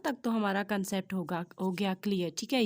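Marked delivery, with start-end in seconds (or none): none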